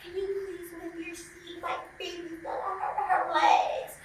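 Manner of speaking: high, soft prissy mom voice; mock fussy mother. Mother on the plane asks him to move his seat: high, soft prissy mock voice.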